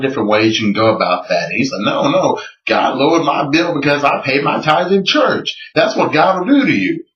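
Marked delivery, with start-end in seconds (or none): 1.8-5.6 higher-pitched quoted protest; imitated insistence. Creationist correcting the doubter about his bill, imitated insistence. 5.7-7.0 high, swooping quoted declaration; imitated conviction. Quoting the creationist's triumphant conclusion.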